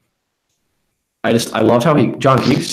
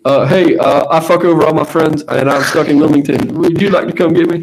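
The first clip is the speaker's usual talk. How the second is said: higher, louder put-on caller voice; stranded friend calling; 6 semitones higher, 3 dB louder than usual. Stranded friend begging a ride from Wilmington, put-on higher voice. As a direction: play the friend sheepishly phoning for a favor. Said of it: "let's, let's act it out".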